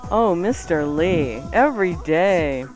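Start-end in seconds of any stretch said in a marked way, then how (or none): none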